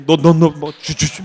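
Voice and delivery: stammered syllables, comic mimicry; imitated stutter. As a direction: trip repeatedly over each first syllable. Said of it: "말 더듬는데도 욕 잘해요". (말 더듬는 친구 흉내)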